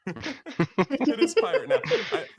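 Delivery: high, loud, breaking up with laughter; cracking up at your own slip; laughing hard. Real, not acted. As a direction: say it through helpless laughter.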